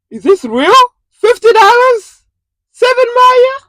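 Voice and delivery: high, loud disbelief; disbelieving shriek. Real, not acted. Driver shrieking at the order's pay to viewers. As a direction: shriek it like you can't believe it.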